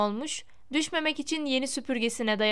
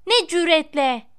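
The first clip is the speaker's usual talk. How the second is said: loud, higher indignant outburst; raised-voice indignation; outraged cry; 4 semitones higher, 7 dB louder than usual. A narrator's reading. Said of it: "diye haykırdı".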